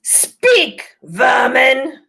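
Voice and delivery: high, loud snarling witch; furious high witch command; witch's angry command. A narrator's reading. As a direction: spit it at the animals. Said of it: "she said again".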